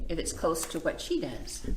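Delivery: normal talking voice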